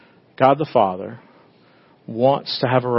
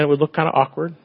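ordinary delivery

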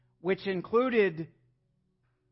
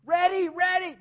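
very high, loud stomping whine; childish high demand, much louder; impatient toddler; 12 semitones higher, 7 dB louder than usual. Retold or imitated. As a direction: stamp your feet like a child. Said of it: "I'm stomping my feet going".

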